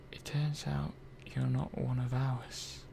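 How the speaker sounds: a worried, hushed confession; hushed whisper. Acted. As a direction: whisper it, half voiced, slowing down. Secret discovery told to robot.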